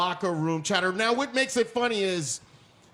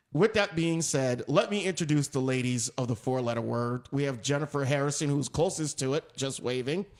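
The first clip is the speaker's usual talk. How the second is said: ordinary talk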